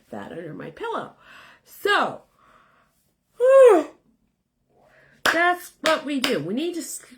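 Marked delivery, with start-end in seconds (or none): 3.4-3.9 loud whew. Real, not acted. Exhale after long explanation.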